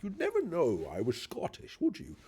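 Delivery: put-on high, lilting Edinburgh Scottish accent; posh Edinburgh Scottish. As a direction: mimic a friend's Edinburgh Scottish lilt. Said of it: "adopt that Edinburgh Scottish accent".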